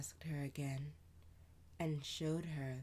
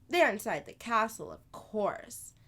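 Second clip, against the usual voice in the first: higher, louder, quick big-sister voice; older sister voice; 7 semitones higher, 10 dB louder than usual. Acted. Sister answering Amani, lively and louder. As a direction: play the lively older sister telling a tale.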